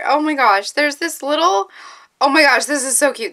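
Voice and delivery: normal talking voice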